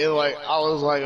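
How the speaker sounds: ordinary talk, nothing marked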